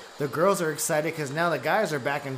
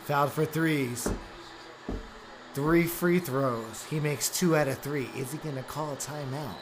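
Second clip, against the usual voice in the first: announcer voice; 4 semitones lower, 6 dB quieter than usual. Acted. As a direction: call the game like a radio host. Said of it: "How you like that announcer voice?".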